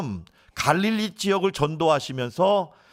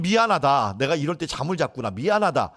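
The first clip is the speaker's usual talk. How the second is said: ordinary talk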